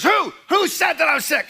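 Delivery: high, loud, offended retort; indignant outburst. Retold or imitated. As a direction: bristle and shout the question back. Indignant man denies being sick.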